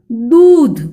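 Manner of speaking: दूध loud with a long ऊ; long vowel demo. Shown as a demonstration. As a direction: stretch the ऊ in दूध. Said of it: "उच्चारण दीर्घ है".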